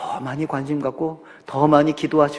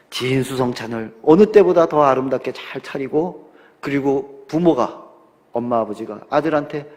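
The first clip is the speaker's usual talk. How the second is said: ordinary talk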